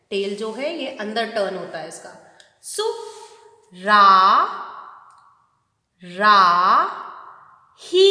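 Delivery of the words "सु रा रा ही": "सु, रा, रा, ही" spelled out slowly, "रा" pressed louder; syllable drill. Shown as a demonstration. Teacher to kids, sounding out "सुराही" loudly. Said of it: "हर मात्रा को आप व्यंजन के साथ बोलेंगे".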